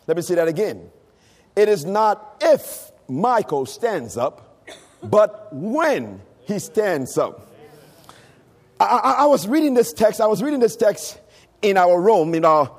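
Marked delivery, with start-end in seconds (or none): 1.6-7.5 slow, with "if" and "when" drawn out and stressed; emphatic repetition. Real, not acted. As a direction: lean hard on "if", pause, then stretch "when". Repeats key line for emphasis.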